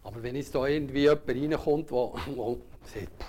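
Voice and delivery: ordinary delivery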